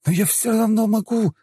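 very high, strained cry; defiant high-pitched yell; stubborn defiance. Acted. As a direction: insist desperately at the top of the voice. Elder stubbornly insists.

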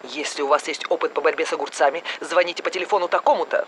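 brisk announcer read; news bulletin. Acted. TV newsreader announces the cucumber epidemic.

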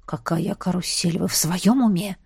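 hushed, indignant growl; quiet growl. A narrator's reading. Duke rebukes the heroine out of earshot, quiet growl. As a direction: scold under your breath. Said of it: "Тихо зарычал он на меня".

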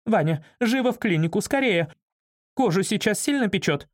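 high-pitched anxious urging; worried assistant on the phone; urgent agitated woman. A narrator's reading. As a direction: press them urgently in a high voice. Frantic assistant urging him on phone.

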